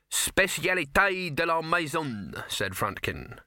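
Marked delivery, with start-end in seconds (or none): 0.1-2.4 French phrase. Acted. Chef boasts in French.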